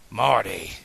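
a firm, falling call of the name; stern. A narrator's reading. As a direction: get his attention sternly. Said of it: "he replied sternly".

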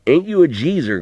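lightly voiced old man, chuckling tease; a hint of a rough country grandpa; old grandpa teasing. A narrator's reading. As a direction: rib the boy like a fond old coot.

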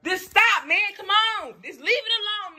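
high-pitched, fed-up plea; exasperated protest. Real, not acted. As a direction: plead sharply for it to stop. Real quarrel, begging him to stop.

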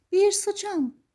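high-pitched storybook fairy; fairy godmother. Acted. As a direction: call out the order in a high voice. Fairy godmother giving orders.

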